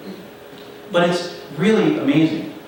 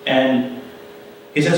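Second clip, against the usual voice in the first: ordinary delivery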